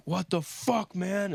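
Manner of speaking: high, indignant outburst from the bouncer; mock outrage at being puked on; a retold bouncer cursing in disbelief; angry bouncer.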